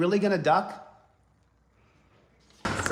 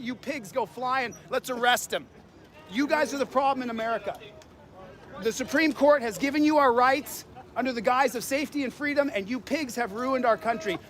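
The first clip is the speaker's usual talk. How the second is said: normal talking voice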